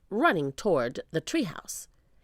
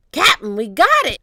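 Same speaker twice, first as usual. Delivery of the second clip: loud, higher pirate shout; triumphant shout; 6 semitones higher, 11 dB louder than usual. A narrator's reading. Pinky reporting to the captain, shouting. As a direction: shout the news to the boss. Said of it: "shouted Pinky".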